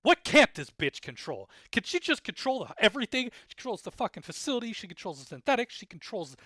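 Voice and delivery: loud, high frustrated ranting; fed-up fan ranting about plot armor, loud; exasperated rant. Real, not acted. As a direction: vent in growing disbelief.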